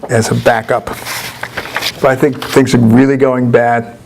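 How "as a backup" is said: ordinary delivery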